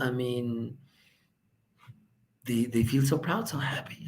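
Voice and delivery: normal talking voice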